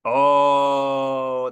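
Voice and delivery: loud, long-held "ohh"; mock dread groan. Speaker introducing the dreaded dip, loud theatrical groan.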